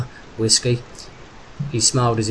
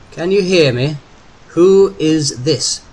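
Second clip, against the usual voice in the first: slow, loud, higher-pitched demand; insistent repeat; 5 semitones higher, 7 dB louder, slower than usual. A narrator's reading. Phone voice to dumbstruck Derek, slowly and loudly insistent. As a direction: spell it out slowly for a silent caller.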